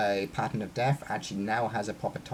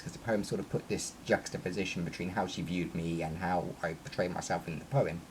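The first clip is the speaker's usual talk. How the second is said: ordinary talk, nothing marked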